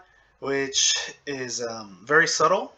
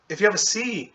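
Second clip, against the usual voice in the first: ordinary delivery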